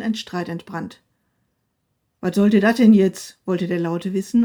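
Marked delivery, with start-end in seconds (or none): 2.2-3.4 loud demanding question; louder than usual. A narrator's reading. Loud husband confronts wife in quarrel, loud. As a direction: demand an answer loudly. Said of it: "seine Stimme war noch lauter als sonst".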